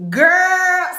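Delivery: loud call out. Real, not acted. Calling to viewers before the story, loud and high.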